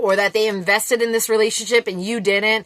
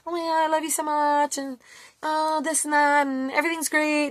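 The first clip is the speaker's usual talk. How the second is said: sweet talker; 6 semitones higher, 5 dB quieter than usual. Retold or imitated. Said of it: "they're all like".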